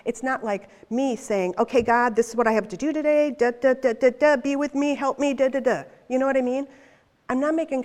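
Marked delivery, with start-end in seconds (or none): 1.6-5.8 loud, hurried rattling-off; mock yourself racing through a list to God; brisk, perfunctory prayer patter; rushed to-do prayer. Mocks her own hurried prayer list.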